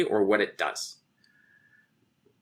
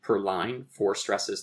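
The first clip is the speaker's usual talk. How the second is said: usual speaking voice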